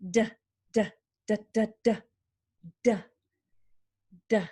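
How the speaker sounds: "d" as short voiced "duh" beats; drum sound. Shown as a demonstration. Therapist imitating a drum, "d" as punchy voiced beats. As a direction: tap out "d" with the voice on, like drum hits. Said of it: "So it sounds like a drum".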